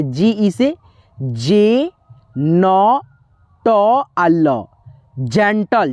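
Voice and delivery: जेंटल broken into j, n, t, al, then said whole; slow syllable drill. Shown as a demonstration. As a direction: sound out each syllable slowly. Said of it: "जेंटल लिखेंगे".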